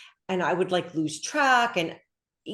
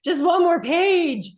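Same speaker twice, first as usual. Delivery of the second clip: much higher, slow, drawn-out plea; pleading mom; 12 semitones higher, 7 dB louder, slower than usual. Retold or imitated. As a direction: stretch it out like begging for more time. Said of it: "And I'd be like".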